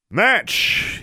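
very high, sharp shout; referee's shouted call; yelled referee call. A narrator's reading. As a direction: call a halt to the bout. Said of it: "yelled Shirtliff".

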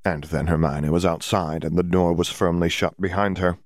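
ordinary delivery, nothing marked

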